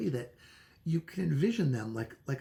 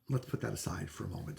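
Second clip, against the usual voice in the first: usual speaking voice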